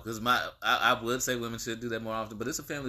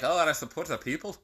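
put-on higher, playful Scottish accent; 6 semitones higher than usual. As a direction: put on a Scottish lilt, pitched up. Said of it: "You actually sound really good when you do that".

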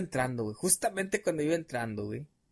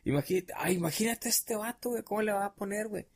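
gossiping classmates; 6 semitones higher, 4 dB quieter than usual. Retold or imitated. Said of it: "iban hablando de eso".

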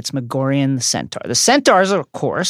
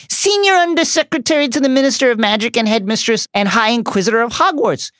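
higher, mock frightened official voice; high-pitched terrified; 8 semitones higher than usual. A narrator's reading. Umbridge listing her titles, nervously high. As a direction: reel off titles in a thin nervous voice. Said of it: "in a high-pitched, terrified voice".

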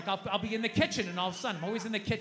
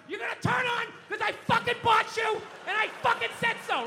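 very high, loud enraged shout; screaming; 12 semitones higher, 5 dB louder than usual. Acted. Dad in the dark ordering the TV on, screaming. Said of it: "don't scream like that".

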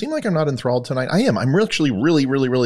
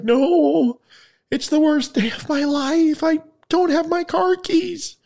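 distraught woman; slower than usual. Acted. Crying woman voiced in joke.

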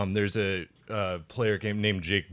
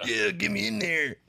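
dumb coal miner voice; 11 semitones higher than usual. Acted. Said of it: "out-of-work coal miner voice".

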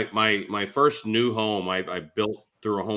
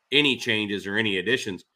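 ordinary talk, nothing marked

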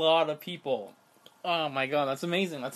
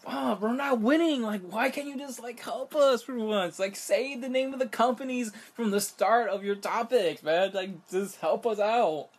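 mock pleading; 5 semitones higher than usual. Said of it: "pleading with the networks".

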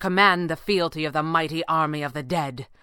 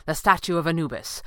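usual speaking voice

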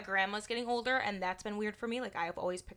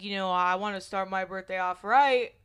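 brother's voice; 6 dB louder than usual. Retold or imitated. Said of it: "he's like".

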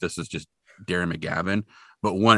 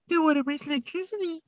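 high mock old-man grumble; squeaky put-on old man repeating the line; mocking the grumpy old man; 4 dB quieter than usual. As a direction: needle him by parroting his line. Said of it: "he's all like".

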